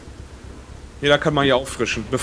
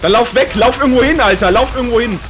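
urgent shouting; 7 semitones higher than usual. Real, not acted. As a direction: yell at them to run. Urging teammate to flee.

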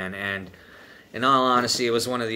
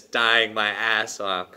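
ordinary talk, nothing marked

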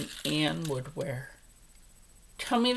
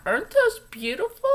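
excited gasp; 6 dB louder, faster than usual. Real, not acted. Showing off new earrings.

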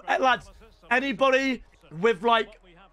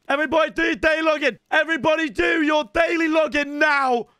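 higher, insistent shouted order; insistent reminder; 5 semitones higher, 3 dB louder than usual. Real, not acted. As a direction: hammer it home to the whole chat. Repeats urgent reminder to viewers.

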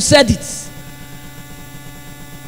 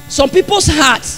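normal talking voice

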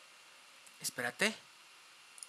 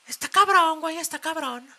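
excited; 10 semitones higher, 6 dB louder than usual. Real, not acted. Stunned by the trailer.

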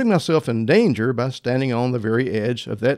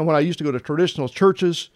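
usual speaking voice

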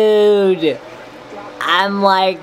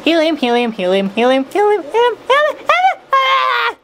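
rising higher and squeakier; helium voice; 10 semitones higher than usual. Real, not acted. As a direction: chant it climbing into helium squeak. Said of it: "I'm almost out of this stuff".

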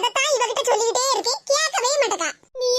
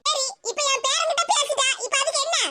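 higher, scolding elder; old mother-in-law; 4 semitones higher than usual. Acted. The grandmother scolds her daughter-in-law for making the girl cry.

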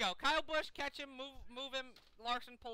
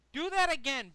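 loud challenge to driver; 5 semitones higher, 10 dB louder than usual. Real, not acted. Calling out at driver.

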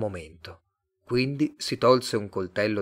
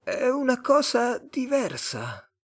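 very high, slow alien; drawn-out Martian voice; slower than usual. A narrator's reading. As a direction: muse slowly in a high alien voice. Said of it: "disse il marziano".

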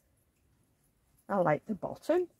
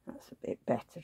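whispered aside; 8 dB quieter than usual. Real, not acted. Fitting socks on doll.

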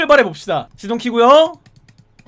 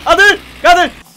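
higher, louder alarmed yells; panicked shout; 6 semitones higher, 5 dB louder than usual. Real, not acted. Streamer watching his car crash, alarmed shouting. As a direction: cry out as it goes wrong.

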